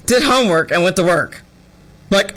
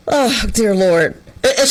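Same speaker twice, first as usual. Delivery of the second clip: ordinary talk, nothing marked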